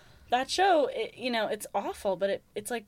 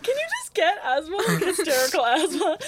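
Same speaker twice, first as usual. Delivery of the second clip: much higher, louder, laughing through the words; laughing hard; 13 semitones higher, 8 dB louder than usual. Real, not acted. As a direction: crack up so hard you can barely speak. Laughing fit after funny line.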